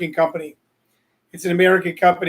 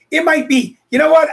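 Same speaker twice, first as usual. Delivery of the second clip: excited outburst; 9 semitones higher, 5 dB louder than usual. Real, not acted. Excited retort about the knee.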